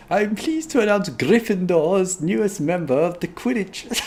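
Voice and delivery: high-pitched, struggling fake Scottish; bad Scottish accent. McGonagall announcing Gryffindor's newest member, bad Scottish accent. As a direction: push through a hopeless Scottish accent. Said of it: "I actually cannot do a Scottish accent".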